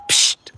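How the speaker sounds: loud "psst"; sudden hissed shoo; sharp shooing noise. Real, not acted. Chicken owner at a little pigeon, loud shooing hiss.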